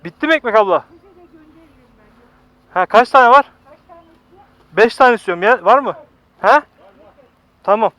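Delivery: loud call across the counter. Real, not acted. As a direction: raise your voice so the seller hears. Calling to the bread seller.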